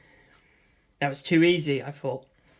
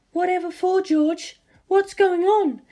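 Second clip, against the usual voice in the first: much higher female voice, puzzled; higher woman's voice, bewildered; puzzled mother; 13 semitones higher than usual. Acted. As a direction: ask in confusion. Mother on the phone.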